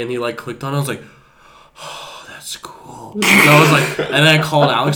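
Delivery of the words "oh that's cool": slow, hushed stoned wonder; retold trippy awe, whispered; awed whisper. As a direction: whisper it slowly, as if entranced.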